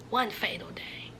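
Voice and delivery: dramatic hushed whisper. A narrator's reading. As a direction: whisper the ominous turn. Dramatic storytelling beat.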